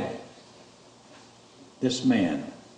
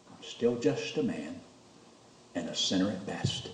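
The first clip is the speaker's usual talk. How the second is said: humble confession; 4 semitones lower, 9 dB quieter than usual. Real, not acted. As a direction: drop low and confess it. Humble confession after building up.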